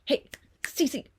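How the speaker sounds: calling out to the cat. Real, not acted. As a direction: call to the cat across the room.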